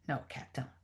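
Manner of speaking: very soft, low dialect reply; lightly voiced character conceding quietly; Jim. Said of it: "try to sort of read the Jim lines".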